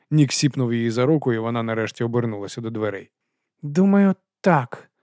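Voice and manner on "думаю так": forced out with effort. Acted. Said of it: "насилу вимовила вона".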